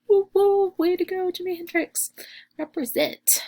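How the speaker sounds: mock crowd whoop in a high voice; playful cheer. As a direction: cheer on a favourite guitarist, pitch way up. Cheering on her left-handed guitar idol.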